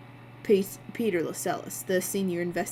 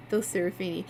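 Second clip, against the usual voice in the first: ordinary delivery, nothing marked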